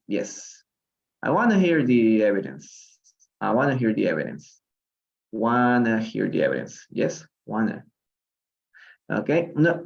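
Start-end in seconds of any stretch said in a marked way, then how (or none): 1.2-6.9 "wanna" with the T dropped; reduced spoken-English "wanna"; connected speech. Shown as a demonstration. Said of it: "normalmente la pronunciación sería como". 7.5-7.9 "wanna" with no T; contracted spoken "wanna"; reduced form. Shown as a demonstration. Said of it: "la T no se pronuncia".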